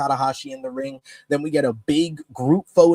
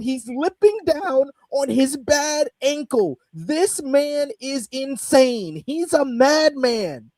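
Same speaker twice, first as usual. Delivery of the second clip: amazed; 9 semitones higher, slower than usual. Retold or imitated. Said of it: "And then I said".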